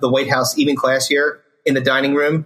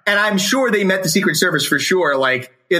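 normal talking voice

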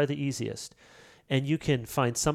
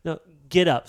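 firm command; 4 semitones higher, 6 dB louder than usual. Retold or imitated. God voiced telling believer to rise.

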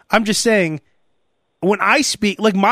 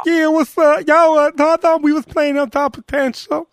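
imitated jock interview voice, pitched up; 9 semitones higher than usual. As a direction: mumble through a mock athlete interview. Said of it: "you hear the [name] or [name]".

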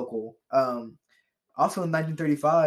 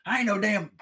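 higher, offended country voice; 6 semitones higher than usual. Retold or imitated. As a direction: huff like an insulted yokel. Joins the creationist impression.

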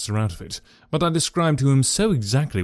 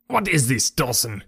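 angry cry. A narrator's reading. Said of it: "he cried".

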